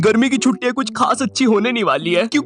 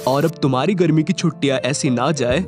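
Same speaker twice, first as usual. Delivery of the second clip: ordinary delivery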